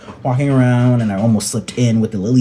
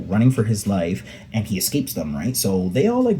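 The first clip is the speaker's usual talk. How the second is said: ordinary talk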